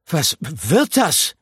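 high, slow, indignant mouse cry; outraged little mouse. A narrator's reading. Mouse indignantly protests being carried off. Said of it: "rief Pikandou entrüstet".

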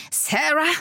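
high, worried question; alarmed questioning call. A narrator's reading. Man holding a limp woman, worried call. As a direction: call the name, checking she responds.

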